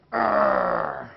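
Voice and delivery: low growl of rage; angry growl. Shown as a demonstration. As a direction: growl like someone spoiling for a fight. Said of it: "They're like this".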